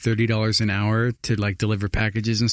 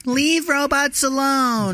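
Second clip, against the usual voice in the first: much higher, slow pleading voice; high protester voice; 13 semitones higher, much slower than usual. Pretend. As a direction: whine like a robot-rights activist. Mock robot-rights activist.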